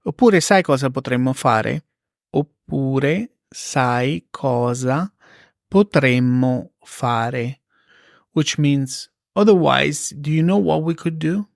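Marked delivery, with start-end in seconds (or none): 2.3-7.6 very slow, spaced repeat; slow teacher model; slow and clear. Shown as a demonstration. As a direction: drag it out for learners. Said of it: "slow repeat for learners".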